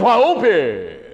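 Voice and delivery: loud, hammed-up preacher exclamation; preacher's voice. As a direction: imitate the reverend with a big falling cry. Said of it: "that's the way he talked right there".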